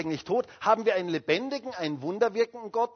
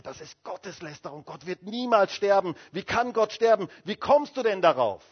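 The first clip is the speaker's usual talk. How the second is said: retold angry explosion; 3 semitones higher, 5 dB louder than usual. Luther exploding at his wife over blasphemy, indignantly. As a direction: mimic an outraged man, voice rising louder. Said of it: "Da explodierte Luther".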